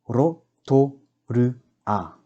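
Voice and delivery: "Rotorua" very slowly split as Ro, to, ru, a; slow teaching breakdown of "Rotorua"; broken into syllables. Shown as a demonstration. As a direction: sound out four separate syllables. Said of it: "break down the words first".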